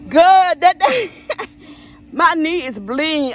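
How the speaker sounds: half laughing, half whimpering, slow; real tearful laugh over the hurt knee; laugh-crying. Real, not acted. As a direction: laugh through the sting of a scraped knee. Shows her bleeding knee.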